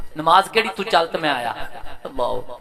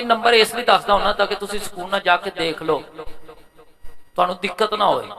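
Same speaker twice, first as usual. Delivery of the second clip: normal talking voice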